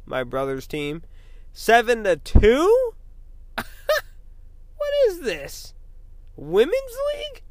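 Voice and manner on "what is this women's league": high-pitched scoffing disbelief; mocking high whine. Mocking his brother's team after a loss, high whine. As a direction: sneer it way up high, mocking the loser.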